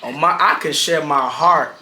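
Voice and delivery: normal talking voice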